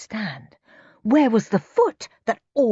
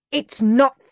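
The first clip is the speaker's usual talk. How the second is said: louder, higher angry shout; 6 semitones higher, 6 dB louder than usual. A narrator's reading. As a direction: shout it at the laughing boy. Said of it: "shouted Dad".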